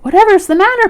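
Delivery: put-on high, loud child character voice; storybook boy's startled query; Christopher Robin. A narrator's reading. Christopher Robin questions panicked Piglet.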